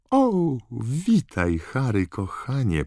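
high, slow, affectionate greeting; motherly warmth in a lifted voice; warm welcome. A narrator's reading. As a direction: coo a fond hello, drawn out. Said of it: "obdarzając go ciepłym uśmiechem".

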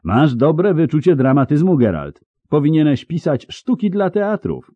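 high, loud excited call; high, theatrical calling out; shouting. A narrator's reading. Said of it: "krzyknął nadchodzący".

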